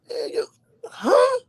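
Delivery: squeaky high disbelieving huh; hearing the coach threatened his legs, disbelieving; incredulous. Retold or imitated. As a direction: react as if you can't believe it. Reacting to leg threat.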